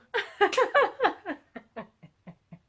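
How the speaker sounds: loud high burst of laughter, trailing off; big belly laugh. Real, not acted. Storyteller cracks up at the joke, helpless laughter. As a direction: crack up at your own punchline.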